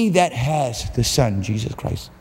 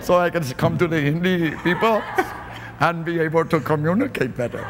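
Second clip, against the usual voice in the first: put-on playful Indian accent, laughing; 3 semitones higher than usual. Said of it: "working on my Indian accent".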